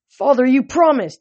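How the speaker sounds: high, loud indignant plea; pleading protest. A narrator's reading. Son reminding father of his promise, pleading.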